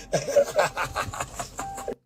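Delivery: bursts into a high burst of laughter; laughing. Real, not acted. Laughing at the punchline of his FBI story. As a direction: cracks up at his own story.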